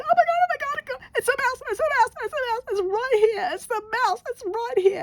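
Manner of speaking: very high, frantic squeal of fright; shrieking at the mouse right by her feet; panicked shriek. Retold or imitated. Woman spots the mouse at her feet, panicked high shriek.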